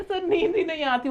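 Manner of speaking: high-pitched student complaint; imitating complaining students. Teacher quoting sleepless students to the class, mock complaint. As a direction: lightly mimic a pupil complaining to sir. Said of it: "कहते हैं".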